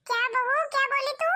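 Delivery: can't believe what she just heard; shocked. Acted. Shocked mother-in-law. (हैरान होकर ऊँची आवाज़ में)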